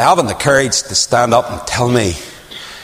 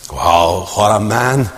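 ordinary talk, nothing marked

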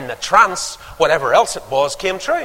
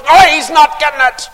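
put-on high, loud, whining child; spoilt brat; 13 semitones higher, 11 dB louder than usual. Spoilt boy refusing to share his toy, screaming.

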